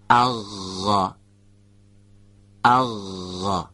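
اغ with a doubled ghayn, the throat friction held longer and stressed; اغ with a doubled, held throat fricative. Shown as a demonstration. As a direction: lean on the gargled غ in اغ and hold it.

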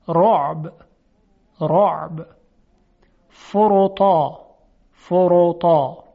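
heavy ر in «رعب» and «فروتا», projected; thick, full r, firmly voiced in «فروتا»; تفخیم. Shown as a demonstration. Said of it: "در صورتی که تفخیم بشه". («رعب، فروتا» با رای درشت)

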